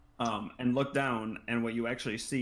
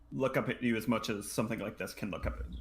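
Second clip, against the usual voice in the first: ordinary talk, nothing marked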